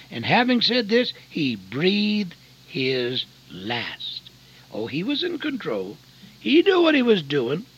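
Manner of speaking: loud, high, slowed preaching; a preacher's rising call; full-force preaching. Acted. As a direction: build the church up with force.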